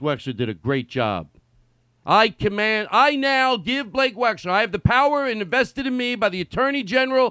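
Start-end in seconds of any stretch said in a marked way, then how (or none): 2.0-7.3 مرسوم رسمي ساخر بصوت عالٍ ونبرة مرتفعة; high, loud, pompous mock decree; mock proclamation. Mock official proclamation of powers.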